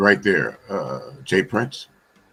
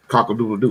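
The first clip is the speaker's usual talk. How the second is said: crowing like a rooster; quick cock-a-doodle-doo crow; mock rooster crow; faster than usual. Mocking caller named Rooster.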